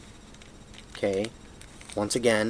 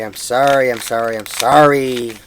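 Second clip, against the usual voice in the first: louder, repeated frantic sorry; loud apology; 8 dB louder than usual. Real, not acted. Teacher apologizes to his viewers, loud flustered sorry.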